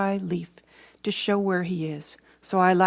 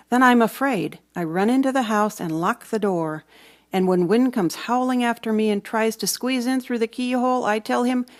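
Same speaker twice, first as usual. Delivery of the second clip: ordinary delivery, nothing marked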